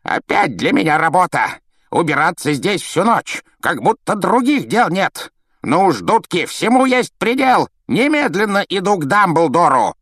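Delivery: high, strained caretaker's tirade; outraged grumbler working up to a yell; raging. A narrator's reading. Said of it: "Филч продолжал бушевать".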